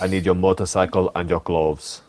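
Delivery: a light take on the cyborg's demand; Terminator quote. Retold or imitated. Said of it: "Doesn't he say something like".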